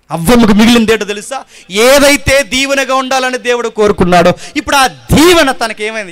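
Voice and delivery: loud, high sermon cry to the congregation; loud pleading preacher; fiery preaching. Acted. Preaching at full force.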